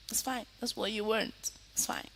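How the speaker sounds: fading to a near whisper; teacher quietly shrugging off the blank screens; resigned murmur. Real, not acted. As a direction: let it trail off, resigned. Resigned about no cameras.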